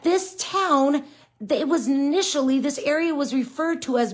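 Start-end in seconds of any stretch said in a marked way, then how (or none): none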